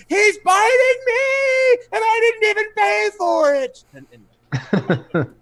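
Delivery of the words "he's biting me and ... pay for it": hammed-up victim's wail, very high and loud; screaming. Bitten man complaining into the smoke, screaming. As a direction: scream it out, stretching the words. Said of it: "he screams out into the smoke".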